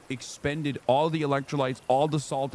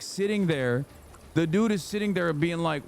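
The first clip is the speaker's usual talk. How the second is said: usual speaking voice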